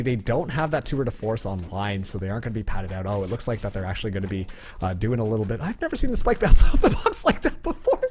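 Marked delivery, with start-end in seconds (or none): 5.6-8.1 laughing mid sentence, high and loud. Real, not acted. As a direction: break into laughter while calling it. Caster laughs at odd spike bounce.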